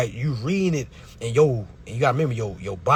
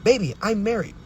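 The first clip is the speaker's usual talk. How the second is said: imitated wife; 6 semitones higher than usual. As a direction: coo it high like a wife breaking news. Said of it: "Imagine your woman that you're married to come to you and say".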